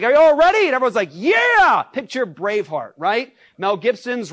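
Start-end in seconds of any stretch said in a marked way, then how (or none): 0.0-0.7 rallying shout. Retold or imitated. Commander rallying the troops. 1.1-1.8 high, loud eager yell; battle cheer. Retold or imitated. Troops cheering back.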